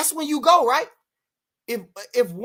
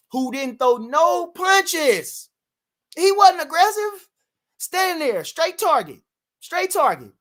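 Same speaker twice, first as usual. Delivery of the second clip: slow, high, louder scorn; mocking disbelief; 8 semitones higher, 4 dB louder, slower than usual. Real, not acted. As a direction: sneer at his passivity. Mocking the passive fighter.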